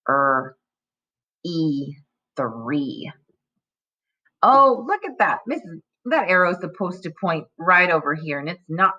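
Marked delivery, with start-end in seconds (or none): 0.1-3.1 Er, E, three segmented then blended; sound by sound. Shown as a demonstration. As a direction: pull the sounds apart, then join. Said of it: "break it into its component parts".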